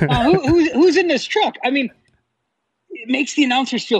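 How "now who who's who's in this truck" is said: higher-pitched feigned bewilderment; mock puzzlement. Announcers pretending not to know the truck's passengers, mock puzzled. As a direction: play the clueless announcer. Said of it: "they still were like".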